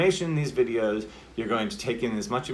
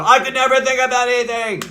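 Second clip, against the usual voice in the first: all-caps full shout, much higher; 13 semitones higher, 11 dB louder than usual. Shown as a demonstration. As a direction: yell it out at full volume. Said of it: "it sounds like shouting".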